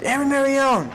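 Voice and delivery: high, sharp call of his name; sharp command. Retold or imitated. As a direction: cut the recruit off mid-dance. Drill sergeant stops him.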